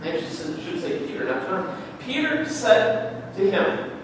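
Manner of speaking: ordinary talk, nothing marked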